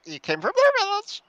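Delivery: high squawky bird voice; parrot voice. Acted. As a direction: screech it like a parrot.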